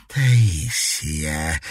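slow name spat through teeth; hissed with hatred. A narrator's reading. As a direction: hate in every syllable. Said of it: "с ненавистью процедила Сентери". (с ненавистью, сквозь зубы)

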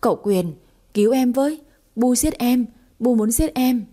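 shouting for help. Acted. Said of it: "ôm mặt hét lớn".

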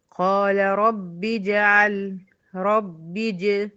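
slow chanted recitation with connected hamza; Quran recitation. Shown as a demonstration. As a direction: recite it slowly, joining the words. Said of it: "هنا في الوصل دخلت بالب المكسورة". (تلاوة مرتلة ببطء)

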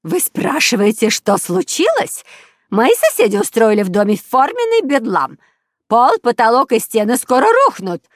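high, loud indignant tirade; outraged shouting. A narrator's reading. Neighbour reporting noisy family to police, outraged shouting. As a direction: rant at full volume down the phone. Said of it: "кричала в трубку фрау Штрудель".

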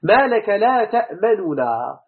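تأمننا said slowly with both nuns separate; reconstructed form spoken deliberately; slow original form. Shown as a demonstration. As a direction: spell out تأمننا slowly, two clear nuns. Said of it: "نا، نا مفعول به".

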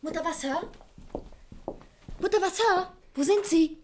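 high call into the house. Acted. Ruft nach der Wirtin. (nach der Wirtin rufen, hoch und laut)

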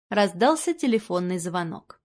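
ordinary delivery

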